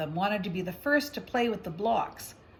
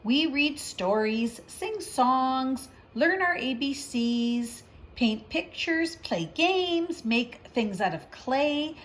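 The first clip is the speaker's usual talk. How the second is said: slow, cheerful list; 2 semitones higher, slower than usual. Acted. Teacher enticing Sister with activities, slow and cheerful. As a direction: lay out each treat slowly to tempt her.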